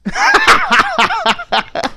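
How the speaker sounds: shrieking laugh, way up high; a real delighted high cackle; high burst of laughter. Real, not acted. Watching the movie, cracks up with a high laugh. Loves the scene.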